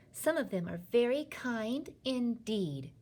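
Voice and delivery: mildly cross, measured rebuttal; angry insistence. A narrator's reading. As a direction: insist indignantly, word by word. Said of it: "Sophie cried angrily".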